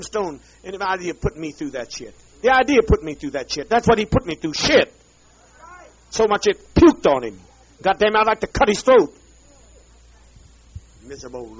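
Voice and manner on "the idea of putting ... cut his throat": loud, furious outburst; bellowing fury; angry shout. Real, not acted. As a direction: spit it out in rage. Raging about Tim Stone.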